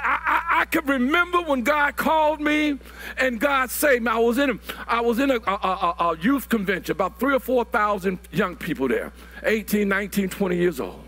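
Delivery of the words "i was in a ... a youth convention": normal talking voice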